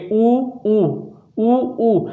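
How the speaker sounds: ऊ said with rounded lips, long and deliberate; lip vowel demo. Shown as a demonstration. Teacher showing students the lip vowel, rounded-lip demo. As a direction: model ऊ as a rounded lip sound. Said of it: "ऊ और ऊ का जब आप उच्चारण करेंगे".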